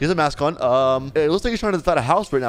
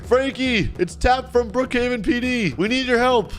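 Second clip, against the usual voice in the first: high-pitched call to someone inside; 6 semitones higher than usual. Pretend. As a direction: call out to the house for help. Calling to a person at home.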